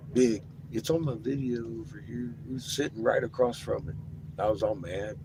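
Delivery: normal talking voice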